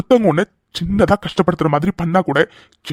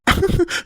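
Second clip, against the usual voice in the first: short high amused laugh; teasing laugh; 12 semitones higher than usual. Acted. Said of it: "இப்படி சிரிக்கிற". (கேலியான சிரிப்பு)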